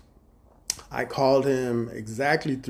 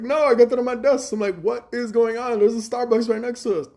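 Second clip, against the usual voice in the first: incredulous; 9 semitones higher, 4 dB louder than usual. Retold or imitated. Confused retold reply to manager.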